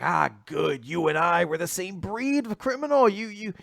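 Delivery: gloating villain, imitated. Villain recruiting a fellow criminal, hammed-up gloating. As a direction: build into a mock-villainous offer. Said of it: "like, laughing to himself".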